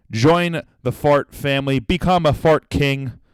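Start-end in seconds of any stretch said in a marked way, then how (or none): none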